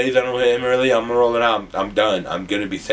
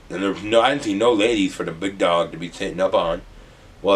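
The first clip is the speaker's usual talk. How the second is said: ordinary talk, nothing marked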